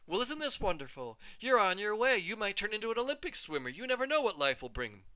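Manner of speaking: high, bright proud praise; mother's delighted voice. A narrator's reading. Mother praising her daughter's diploma, high and gushing. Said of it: "my mother carefully untied".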